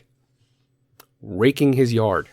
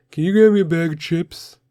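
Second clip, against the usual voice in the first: put-on demanding kid; 7 semitones higher, 4 dB louder than usual.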